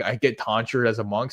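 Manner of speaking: ordinary talk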